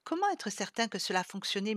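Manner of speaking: usual speaking voice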